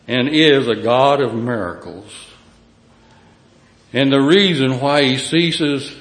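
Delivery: fiery preaching. Acted. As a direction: proclaim it from the pulpit. Preaching scripture at full force.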